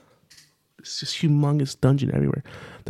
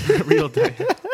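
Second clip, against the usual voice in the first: high, slow, laughing repeat; words breaking into high-pitched laughter; real laughter. Real, not acted. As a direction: crack up while repeating the silly phrase. Cracking up at the garbled phrase.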